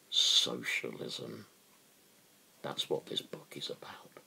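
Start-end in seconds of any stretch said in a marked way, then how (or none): 2.6-4.2 hushed confiding whisper. Real, not acted. Telling listeners what the book is about, in a whisper. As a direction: lean in and whisper it. Aside after rousing speech.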